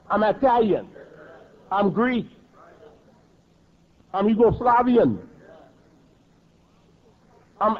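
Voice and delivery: ordinary delivery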